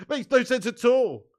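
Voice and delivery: loud, high, slowed-down protest; exasperated disbelief. Real, not acted. Streamer scoffing at where soccer comes from, exasperated. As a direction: spell out the absurdity, loud and slow.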